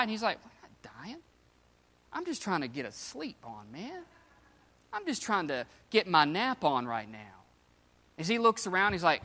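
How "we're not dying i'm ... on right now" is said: very soft, acted just-woken complaint; low-volume, mock-weary protest; hushed sleepy grumble. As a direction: grumble under your breath as if roused from a nap. Woken sleeper grumbles about his nap.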